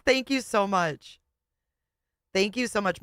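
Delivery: ordinary talk, nothing marked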